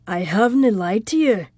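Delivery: mildly intense, restrained male character voice; a touch of contained intensity. A narrator's reading. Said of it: "his voice was low and intense".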